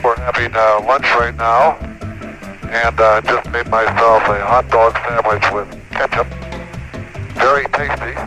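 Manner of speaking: crackly radio transmission from space; distorted astronaut radio; breaking up space radio. Real, not acted.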